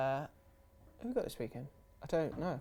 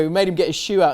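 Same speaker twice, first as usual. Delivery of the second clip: normal talking voice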